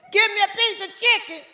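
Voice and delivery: put-on high, loud childish demand; be the baby of seven demanding food; little girl voice. Imitating her childhood self demanding chicken, high and loud.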